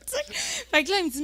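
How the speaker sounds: usual speaking voice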